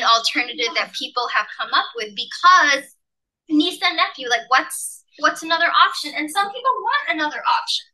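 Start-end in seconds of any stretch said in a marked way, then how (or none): none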